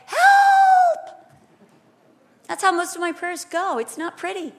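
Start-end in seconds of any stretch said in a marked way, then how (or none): 0.1-1.2 high, loud, long-held yell; desperate plea yelled at the top of the voice; cry for help. Acted. Woman throwing herself before the throne of grace, desperate yell. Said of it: "and say".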